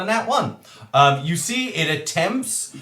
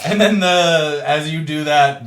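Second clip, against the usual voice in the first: ordinary delivery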